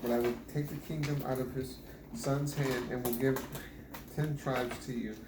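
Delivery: normal talking voice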